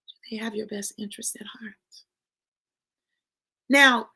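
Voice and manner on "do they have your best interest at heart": voiceless probing whisper. Real, not acted. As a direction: ask it like a secret to yourself. Private self-question.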